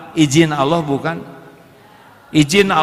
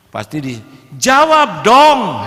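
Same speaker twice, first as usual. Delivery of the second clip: defiant retort; 5 semitones higher, 6 dB louder, much slower than usual. Retold or imitated. Provoked wife urged to reply.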